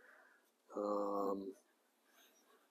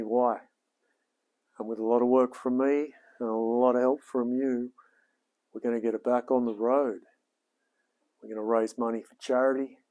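upbeat presenter read to camera; a rehearsed intro spoken straight to the viewers; channel intro take. Shown as a demonstration. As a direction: deliver the show intro like a host. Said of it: "That was all right. That was a good one".

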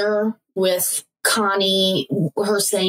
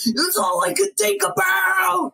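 higher, slow hammed-up gush; mock overexcited; 9 semitones higher, slower than usual. Mocking her own boy-crazy phase, hammed-up gush. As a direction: gush it like a lovestruck teen.

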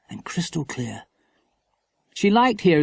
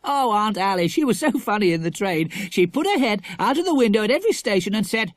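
higher, eager schoolboy; animated boy's chatter, pitched up; lively boy; 8 semitones higher than usual. A narrator's reading. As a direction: tell a funny tale excitedly. Jack tells a funny tale.

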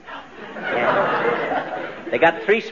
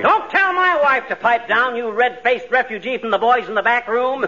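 indignant retort; 6 semitones higher than usual. Acted. Husband snaps at cop over wife.

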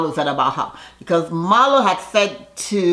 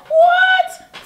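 a shrieking, very high, louder "What?"; shocked what; 6 dB louder than usual. Retold or imitated. Ladies learning about the private jet, shrieking shock. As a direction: squeal it in disbelief.

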